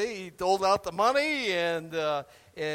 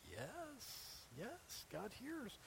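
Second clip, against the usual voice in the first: hushed reassurance; 4 semitones higher, 22 dB quieter, much slower than usual. Real, not acted. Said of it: "quiet reassurance".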